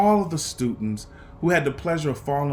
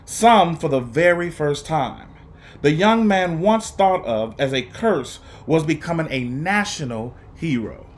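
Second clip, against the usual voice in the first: put-on expressive read-aloud for young students; lively, paced story reading with dramatic pauses; storybook narration; 2 semitones higher, 5 dB louder than usual. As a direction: read it like a teacher sharing a picture book with the class. Teacher reads picture book aloud.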